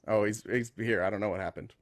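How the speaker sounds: ordinary delivery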